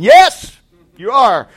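shouted high and loud; fiery interjection. Acted. Preacher answering the giant in a shout. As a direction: fire it back at the giant.

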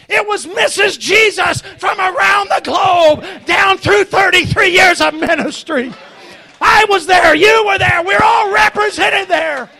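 high, loud revival preaching; impassioned preaching. Real, not acted. Preacher to congregation about the converts, impassioned. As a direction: preach it with rising force, ringing out.

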